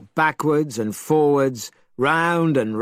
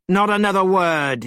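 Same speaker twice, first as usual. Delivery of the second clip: higher, louder sharp cutoff; Monkey silencing the priest, angry; angry cry; 7 semitones higher, 4 dB louder than usual. A narrator's reading. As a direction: shut him up hard. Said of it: "he cried".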